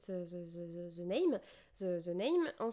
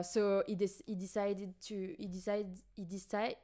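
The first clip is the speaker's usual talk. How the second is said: ordinary talk, nothing marked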